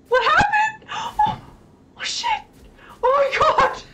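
high panicked shriek; scared screaming. Real, not acted. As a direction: scream in real fright. Startled by game attack.

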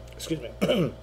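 ordinary talk, nothing marked

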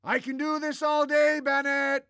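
high, loud taunt; taunting shout. Acted. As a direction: call out over his screams, high and loud. Taunts him over his screams.

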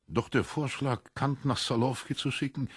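usual speaking voice

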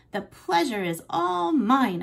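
high, slow squeak; squeaky. Acted. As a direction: squeak it out politely. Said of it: "I squeaked".

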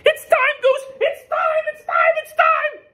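very high and loud, frantic; frantic Duck. Acted. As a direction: shriek it like a panicking duck. Egg is hatching.

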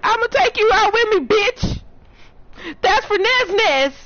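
high, loud battle cry; revenge yell. Real, not acted. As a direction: scream it while taking them down. Revenge on killer.

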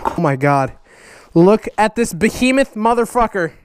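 normal talking voice

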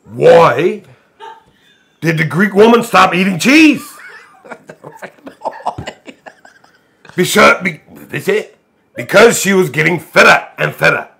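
angry. Shown as a demonstration. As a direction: read it like you're mad. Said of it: "Read the joke like you're angry".

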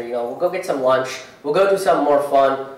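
usual speaking voice

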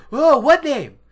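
loud, high exclaimed question; hammed-up alarmed question; urgent demand. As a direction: blurt it out impatiently. [name] anxiously demanding the name.

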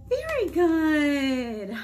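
big cheer. Real, not acted. Librarian cheering the children after the song, loud praise.

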